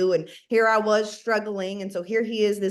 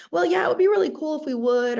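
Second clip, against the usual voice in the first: wishful hedging; 7 semitones higher, faster than usual. Retold or imitated. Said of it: "So we say things like".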